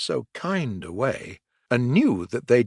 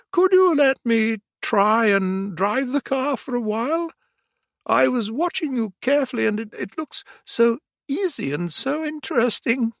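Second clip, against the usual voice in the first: eager washerwoman; 12 semitones higher than usual. A narrator's reading. Toad asks eagerly.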